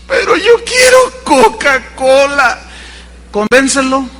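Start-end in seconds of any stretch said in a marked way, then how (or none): 0.1-2.6 put-on whining kid. Child whines for Coca-Cola.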